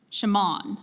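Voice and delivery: "shaman" as shuh-MAHN, stress on the last syllable; third alternate pronunciation. Shown as a demonstration. Pronouncer offers the speller alternate pronunciations, "shaman" as shuh-MAHN. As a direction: reduce the first vowel of "shaman", land on MAHN. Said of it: "a couple alternate pronunciations".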